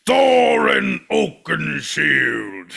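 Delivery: slow, high, booming shout; dwarf king. Acted. King under the mountain names himself, drawn-out shout. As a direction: roar the name as a long heroic cry.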